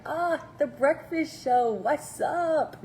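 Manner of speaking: mock-cheery "ah" like spotting an old friend; excited returning viewer. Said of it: "you're like".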